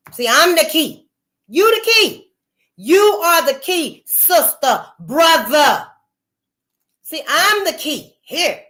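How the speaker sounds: exhorting the listeners at full force; preaching. Real, not acted. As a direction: fire it at the congregation. Preaching to the viewers.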